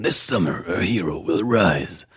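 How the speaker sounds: slow, dramatic movie trailer voice. Shown as a demonstration. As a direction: announce it like an epic blockbuster trailer. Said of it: "ich sollte Trailer sprechen".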